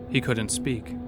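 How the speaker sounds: ordinary talk, nothing marked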